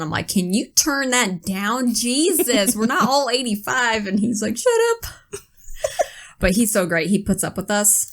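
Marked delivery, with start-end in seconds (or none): 0.3-4.1 higher, exasperated retold complaint; mock exasperation. 4.6-5.1 very high mock-defensive squeal; defensive comeback. Retold comeback to teasing.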